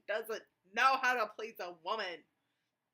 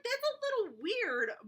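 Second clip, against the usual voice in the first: usual speaking voice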